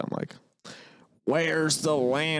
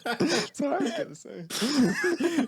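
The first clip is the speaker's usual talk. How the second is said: high amused aside; 14 semitones higher, much slower than usual. Real, not acted. Laughing aside, high slow.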